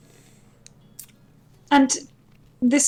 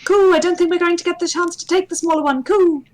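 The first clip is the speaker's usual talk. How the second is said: high, louder pigeon coo; 12 semitones higher, 5 dB louder than usual. Acted. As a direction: answer as a high-voiced cooing pigeon. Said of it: "Fucking pigeon in the house".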